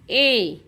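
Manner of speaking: ए as a lone held "eh" vowel; phonic E sound. Shown as a demonstration. Teacher showing the sound of E, ए as "eh". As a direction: sound out ए on its own. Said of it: "ईचा उच्चार होतो".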